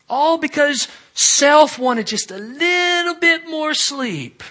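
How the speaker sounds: high, loud, drawn-out exclamation; impassioned preaching. Real, not acted. Preacher mocking self's wish for sleep, high and drawn out. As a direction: stretch it out high and loud, in mockery of self.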